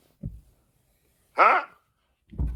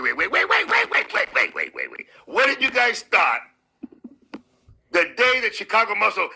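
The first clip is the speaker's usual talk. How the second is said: ordinary delivery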